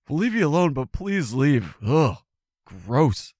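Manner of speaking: high start sinking into disgust; hammed-up queasy revulsion; mock repulsed. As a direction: shoo them away, grossed out. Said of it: "completely repulsed by human women".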